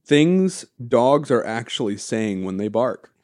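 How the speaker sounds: ordinary talk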